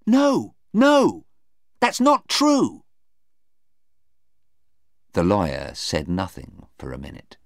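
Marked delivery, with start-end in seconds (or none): 0.1-2.8 very high, louder protest; distressed denial. Acted. Sarah denying the lawyer's accusation, distressed. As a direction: cry out in protest against the charge.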